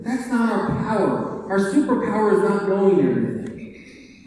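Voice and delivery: normal talking voice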